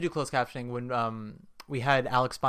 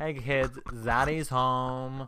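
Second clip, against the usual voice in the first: Zaddy voice; much slower than usual. Pretend. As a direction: drawl it out slow like a cool dad. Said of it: "I'm like a Zaddy Kardashian".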